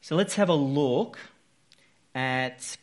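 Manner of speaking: ordinary talk, nothing marked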